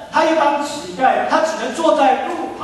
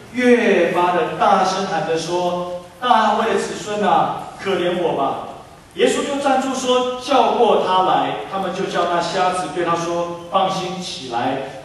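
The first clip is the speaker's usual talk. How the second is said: measured, unhurried Bible reading; scripture reading; 8 semitones lower than usual. Acted. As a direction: read the passage to the congregation. Reads the scripture passage aloud.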